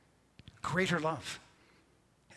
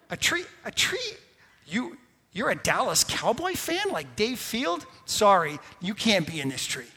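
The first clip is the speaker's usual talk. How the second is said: lightly voiced snooty tree, higher and louder; mock the tree turning away a Cowboys fan; petty tree; 5 semitones higher, 5 dB louder than usual. Preacher mocks a tree excluding a Dallas fan, snooty. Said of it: "tree looking down going".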